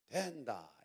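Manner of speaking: DOEN-da in a Gyeongsang dialect lilt; Gyeongsang accent. Shown as a demonstration. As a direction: demonstrate the Gyeongsang-do version. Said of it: "경상도 버전으로".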